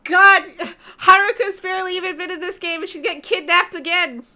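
loud frustrated yell at the game; outraged shout. Real, not acted. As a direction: shout it out in exasperation. Game character kidnapped again, outrage.

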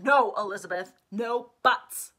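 loud, cutting her off hard; firm loud refusal. Acted. Older protector silencing Elizabeth with a loud refusal. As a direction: shut down the argument with a loud no. Stefan cuts off Alex's protest.

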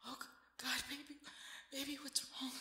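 alarmed. Acted. Waking, seeing partner distressed.

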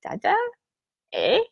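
put-on high, small child's voice with a questioning "eh"; imitated little daughter. Daughter copying her dad's Canadian talk, imitated child voice. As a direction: pipe up high like a little kid. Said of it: "my daughter will be like".

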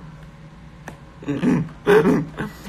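put-on throaty laugh in short bursts; deliberately forced, stuttering chuckle; practiced new laugh. Shown as a demonstration. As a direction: try out an odd fake laugh. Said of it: "I'm practicing a new laugh".

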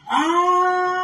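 high, held ghostly moan; long lament. Retold or imitated. Streamer shows how the real Llorona sounds: a high held moan. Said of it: "no, dice".

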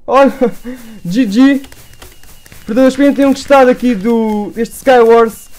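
loud, high victory outro; excited outro. Real, not acted. Streamer loudly celebrating the win and signing off. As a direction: call it out triumphantly.

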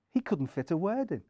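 usual speaking voice

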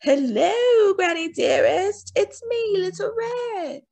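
wolf pretending to be a child; fake little girl voice. A narrator's reading. Wolf tricking granny, in a high put-on girl voice.

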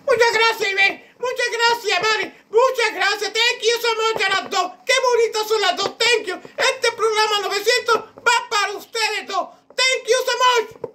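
very high squeaky witch voice, gushing thanks. Acted. The witch thanks two sisters in a squeaky witch voice. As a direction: chirp your thanks like a giddy witch.

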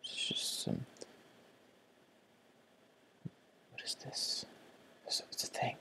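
hushed whisper. Real, not acted. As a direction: whisper under your breath. Hushed exploring.

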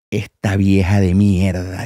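low, slow mock grumble; slow, deep retold insult; imitated muttering host. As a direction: drag it out low, imitating a voice from the back of the booth. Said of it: "desde el fondo de la cabina".

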